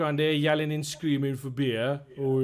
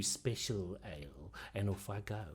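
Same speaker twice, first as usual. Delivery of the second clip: muttered aside; 4 semitones lower, 12 dB quieter, slower than usual. Acted. Barmaid mutters leaving.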